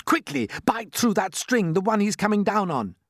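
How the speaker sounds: high, urgent order; urgent yell. A narrator's reading. James ordering the centipede to bite the string, urgent yell. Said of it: "yelled James".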